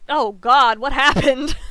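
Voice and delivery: loud, hammed-up dismay; mock alarm. Reacting to own word coming out with twang, mock alarm. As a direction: exclaim in comic panic.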